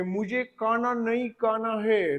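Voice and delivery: slow, lightly faked English accent on Hindi; a hint of an Englishman mangling Hindi; mock foreign Hindi accent. Teacher imitating an Englishman speaking Hindi, lightly accented. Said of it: "cuteness से बोला है".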